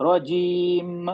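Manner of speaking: رجیم held very long, lips closed at the end; very slow model reading of رجیم; drawn-out recitation demo. Shown as a demonstration. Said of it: "آپ ہونٹوں کھول دے رہی ہیں".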